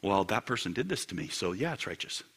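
low, soft, defensive excuse; muted self-justification. Acted. Person defending their own anger, quiet and low. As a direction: justify yourself under your voice.

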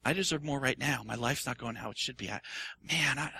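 understated whiny self-pity; mock self-pity. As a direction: pout and feel sorry for yourself. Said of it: "Self-pity".

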